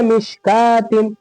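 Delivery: मिशकातिन slowly, long "kaa", no "w"; correct silent-waw reading. Shown as a demonstration. Teacher recapping the right reading, slow and careful. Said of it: "तो ये क्या हो गया?".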